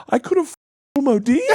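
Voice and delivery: high, loud, stunned question; shocked disbelief. Retold or imitated. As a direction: squeal it in disbelief. Shocked exclamation retold.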